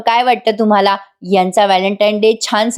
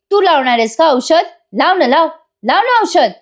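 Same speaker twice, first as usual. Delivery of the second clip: higher-pitched, acted jealous snapping; irritated challenge; 7 semitones higher than usual. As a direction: imitate an annoyed dare, pitch jumping up. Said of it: "असं म्हणून काव्या आता चिडून रम्याकडे बघते".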